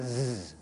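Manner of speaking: "z" held as a long voiced buzz; extended z. Shown as a demonstration. Teacher contrasting two sounds, "z" held as a buzz. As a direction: draw "z" out as a buzzing sound. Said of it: "what's the difference between making".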